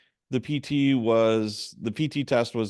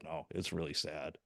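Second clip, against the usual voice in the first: subdued admission; 5 semitones lower, 15 dB quieter than usual. Retold or imitated. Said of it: "And she said".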